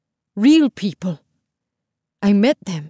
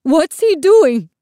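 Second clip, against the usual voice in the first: high, loud, slow disbelief; frantic raised-voice question; alarmed cry; 9 semitones higher, 6 dB louder, slower than usual. A narrator's reading. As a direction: cry out in alarm at what he's done. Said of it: "Isabel's mother cried".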